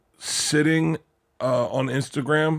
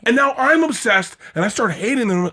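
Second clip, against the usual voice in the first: spiraling rant; 11 semitones higher, 4 dB louder than usual. Retold or imitated. Acting out spiraling jealous thoughts.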